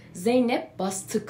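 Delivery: usual speaking voice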